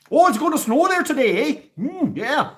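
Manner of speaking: very high, loud Midwestern small talk; high Midwestern accent. Acted. Predicting snow in a high, hammed-up Midwestern accent. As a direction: ham up a Midwestern local. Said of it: "a high Midwestern accent".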